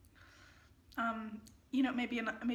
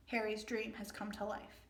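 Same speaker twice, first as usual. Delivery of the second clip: ordinary talk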